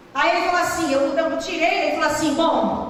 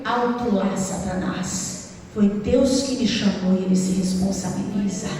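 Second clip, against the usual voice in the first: low, slow, controlled rebuke; firm rebuke; 9 semitones lower, slower than usual. Retold or imitated. Preacher retelling her answer to Satan, firm rebuke. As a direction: stop the devil with a deep, measured command.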